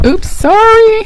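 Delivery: surprised. Real, not acted. Fumbling fabric on camera.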